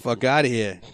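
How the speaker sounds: normal talking voice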